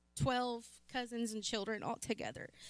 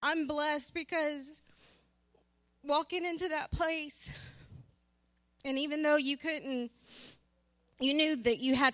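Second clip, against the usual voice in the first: higher voice, welling up for real; halting testimony, pitched up with feeling; emotional; 4 semitones higher than usual. Real, not acted. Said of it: "Sorry if I'm a little emotional".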